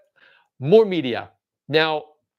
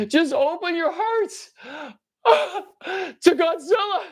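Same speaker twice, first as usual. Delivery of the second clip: mock preacher; 9 semitones higher, much slower than usual. Preachy high slow.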